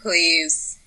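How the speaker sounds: pleading prayer. Retold or imitated. Praying to get through a risky outing, mock pleading. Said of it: "I've been praying every night".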